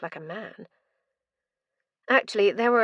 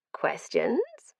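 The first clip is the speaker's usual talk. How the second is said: gleeful madman; 5 semitones higher than usual. Acted. Mad prisoner.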